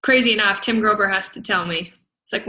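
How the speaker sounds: ordinary talk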